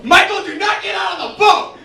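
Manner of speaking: high mock-shrieked order; sister's panicked scream. Sister in the raft to her brother, screaming. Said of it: "stops screaming long enough to go".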